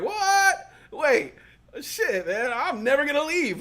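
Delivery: high, loud startled what with a laugh; alarmed yelp. Retold or imitated. Realizing coworkers gossip, startled high laughing yelp. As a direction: jump in alarm, half laughing.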